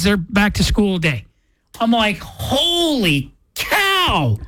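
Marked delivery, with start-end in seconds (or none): 2.2-4.4 long, drawn-out awe; slow, higher-pitched wonder; amazed. Retold or imitated. Amazed reaction to an old memory.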